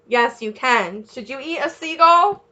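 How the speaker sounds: normal talking voice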